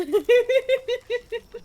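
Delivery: high, loud laugh; high burst of laughter. Real, not acted. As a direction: crack up loudly. Laughing at own joke.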